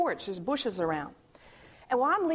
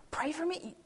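imitated near-whisper of surprise; hushed disbelief; 3 semitones higher, 6 dB quieter than usual. Young man in the garage offered prayer, hushed disbelief. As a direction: whisper it as an incredulous question.

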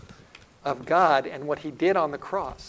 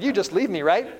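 lightly voiced child, higher and louder; a hint of a little boy checking; young son; 6 semitones higher, 6 dB louder than usual. Son checks dad would leave him, lightly childlike. Preacher repeats son's question.

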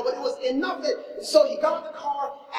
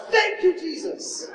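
laughing thanks; 9 semitones higher, slower than usual. Retold or imitated. Retold joy at the accident.

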